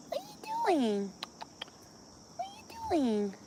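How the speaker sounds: high, soft, drawn-out pet talk; cooing to cat. Real, not acted. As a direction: coo it gently to a cat in a tiny high voice.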